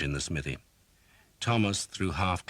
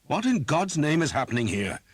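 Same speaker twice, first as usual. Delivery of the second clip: much higher, louder outburst; outraged demand; 12 semitones higher, 7 dB louder than usual. Acted. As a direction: storm in demanding answers. Priest bursts in on torture.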